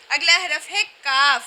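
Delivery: ordinary talk, nothing marked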